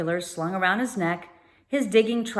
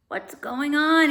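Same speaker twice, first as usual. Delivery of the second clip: mumbling; 8 semitones higher, 4 dB louder, slower than usual. A narrator's reading. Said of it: "he mumbled".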